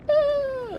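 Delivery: sharp high loud yelp; sudden startled cry. Real, not acted.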